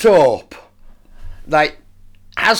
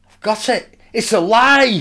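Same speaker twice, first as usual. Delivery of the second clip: higher, eureka moment; excited realisation; 6 semitones higher than usual. Retold or imitated. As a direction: blurt out the discovery, pitched up. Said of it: "he suddenly pops up".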